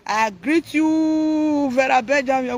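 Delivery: high, loud, long-drawn mock greeting; exaggerated drawn-out imitation; mimicked greeting. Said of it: "they talk like this".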